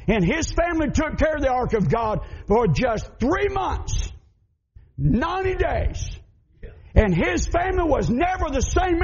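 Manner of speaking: preacher shouting the blessing; climactic preaching. Acted. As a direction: drive it home to the congregation. Sermon climax on Obed-Edom.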